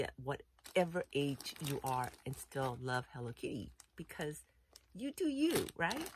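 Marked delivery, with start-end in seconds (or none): 4.9-6.1 much higher and louder, cheering them on; emphatic cheer. Real, not acted. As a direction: lift it up high like a rallying cry. Cheering viewers to be themselves.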